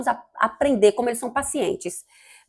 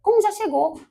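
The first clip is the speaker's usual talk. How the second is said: high-pitched baffled question; puzzled, much higher than usual; acted bewilderment; 10 semitones higher than usual. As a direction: play someone caught off guard, voice jumping up. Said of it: "a gente vai ficar assim, hã?".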